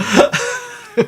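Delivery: high burst of laughter; real laugh. Real, not acted. Player laughing.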